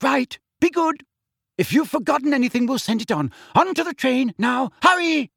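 high, loud, rushed mother; herding the kids onto the train; fussing mother. Acted. Mother hurries children onto train.

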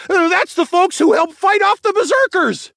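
excited, high-pitched holler; calling out. A narrator's reading. Townsperson points out the berserker fighters, calling out. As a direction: shout it to the whole crowd. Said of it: "someone called out".

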